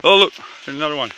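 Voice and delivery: usual speaking voice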